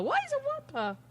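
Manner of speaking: high puzzled question. Real, not acted.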